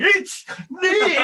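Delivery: high shouted call while chasing. Retold or imitated. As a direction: yell it mid-chase. Chasing a dog, calling out.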